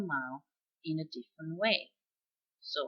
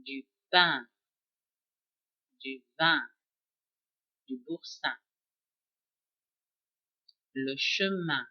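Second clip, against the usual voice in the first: French words; 3 semitones lower, 3 dB louder than usual. Shown as a demonstration. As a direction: say each French word clearly for students to repeat. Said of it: "repeat after me".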